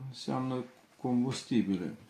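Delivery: ordinary talk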